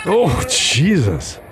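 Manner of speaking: high, long drawn-out dismay; appalled reaction. Real, not acted. Host reacting to a vomit video, appalled. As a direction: stretch it out, aghast.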